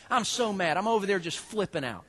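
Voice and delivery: ordinary talk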